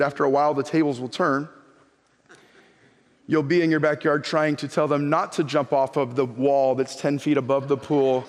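ordinary delivery

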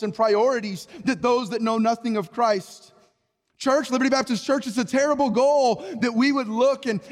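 fiery sermon voice; preaching. Acted. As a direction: drive the warning home from the pulpit. Preaching appeal to groups.

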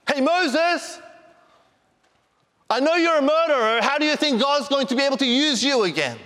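high-pitched accusing devil voice; acted tempter, high and goading; taunting devil. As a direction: needle him as the devil, pitched well up. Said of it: "then he jumps in and he will start, begin to sow that seed".

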